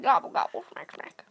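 monster gobbling. Pretend. Monster eating sounds.

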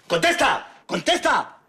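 loud, high repeated yelling; father screaming at his son; furious shout. Acted. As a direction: scream the demand over and over. Father demands an answer.

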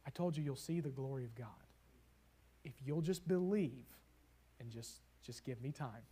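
gentle loving reminder. Acted. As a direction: soothe them, soft and loving. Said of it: "such a gentle, loving reminder".

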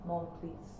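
ordinary talk, nothing marked

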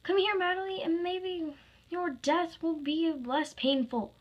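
high, loud villainous menace; high-pitched acted fury; furious threat. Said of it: "furious as ever".

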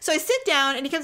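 normal talking voice

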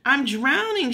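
half-hearted scream for help; attempted scream. A narrator's reading. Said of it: "Sheila tried to scream".

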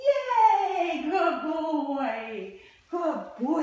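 loud, bright cheer for the puppy; delighted praise. Real, not acted. Trainer cheering her puppy for lying down, loud and delighted. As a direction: reward him with a burst of joy.

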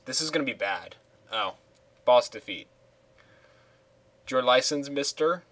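ordinary talk

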